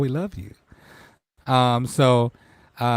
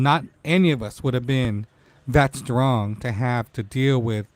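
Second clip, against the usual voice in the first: ordinary talk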